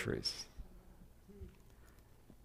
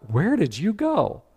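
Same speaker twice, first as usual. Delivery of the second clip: slow, higher, amazed; shocked question; 6 semitones higher than usual. Retold or imitated. Naomi demands to know where Ruth went, slow and amazed. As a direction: draw it out in shock.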